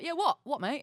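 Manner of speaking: quick mock English-accent retort; imitated English mate. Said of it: "And Dave's like".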